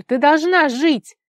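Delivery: high, loud, drawn-out cry; desperate shout. A narrator's reading. Tigir begs his friend to save herself, desperate shout. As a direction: yell it slowly, pleading for her life. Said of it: "крикнул Тигир".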